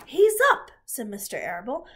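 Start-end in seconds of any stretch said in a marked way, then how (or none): 0.1-0.7 high, loud exclamation; sudden excited shout; excited announcement. A narrator's reading. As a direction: call out the good news. Announces the pig has revived.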